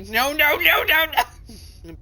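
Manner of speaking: high, loud panicked protest. Acted. Player panics as his power keeps climbing, high frantic protest. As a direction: beg it to stop in rising panic.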